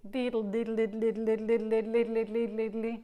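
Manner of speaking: diddle, diddle rattled off fast on the dl; a string of quick diddles; rapid diddle drill. Shown as a demonstration. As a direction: fire off diddle like a tonguing exercise. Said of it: "And the dl makes".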